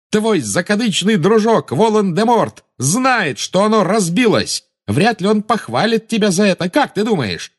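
high, heated mocking cry; defiant taunting. A narrator's reading. Boy mocks the witch about her master, taunting loudly.

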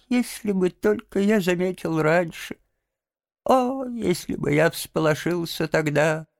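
high, frail, plaintive invalid; ailing Toad, babbling. A narrator's reading. Sick Toad bewailing to Rat, feeble babbling. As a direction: pile on the martyrdom in a thin high voice.

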